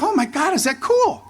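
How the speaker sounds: high, giddy disbelief; starstruck thrill. Retold or imitated. Player hearing from his idol on the phone, high and giddy. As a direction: gush like a thrilled kid. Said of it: "I'm like".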